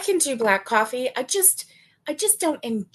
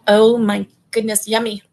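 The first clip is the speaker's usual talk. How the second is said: savoring delight; 9 dB louder than usual. Real, not acted. Savoring her coffee.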